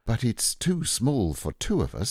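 put-on a touch of childlike disappointment; sad. A narrator's reading. Boy finding the boat too small, mildly sad. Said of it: "said Christopher Robin sadly".

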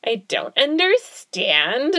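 overdone podcast-host bewilderment; exaggerated podcast voice. Real, not acted. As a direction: stretch it out slowly with a swooping, high "understand". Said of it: "is that what my podcast voice is gonna be like".

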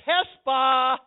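loud, drawn-out "Hespah"; ringing preacher's shout on "Hespah"; triumphant proclamation. Acted. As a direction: proclaim the name at full force. Proclaims the new name.

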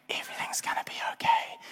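breathy whispered reassurance; a hushed whisper of false calm. Retold or imitated. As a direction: whisper it to yourself, hoping. Reassuring while waiting on wall.

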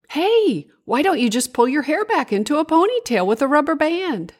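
high, quick storybook moth; eager friend making a suggestion, high and fast; helpful moth character. A narrator's reading. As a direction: pitch it up and rattle out a bright idea.

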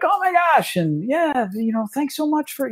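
high gushing thanks from admiring players; gushing fans. Retold or imitated. Face Hammer players greeting the organizer, high gushing thanks. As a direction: gush like a star-struck fan. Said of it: "and they're like".

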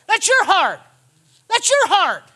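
building loud. Shown as a demonstration. Preacher confronting congregation, repeats rising to a shout. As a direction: drive That's your heart home, rising.

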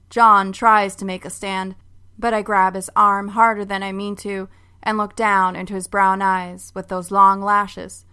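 ordinary delivery

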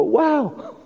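high, breathless wow of relief; awed wow. Retold or imitated. Builder friend offers help, awed high wow. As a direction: let the wow rise high in wonder. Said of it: "you'd go".

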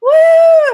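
high, loud "woo"; loud crowd whoop. Retold or imitated. Storyteller imitating an excited audience, loud high whoop.

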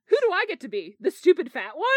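loud, high-pitched unhappy protest. A narrator's reading. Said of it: "Peter asked unhappily".

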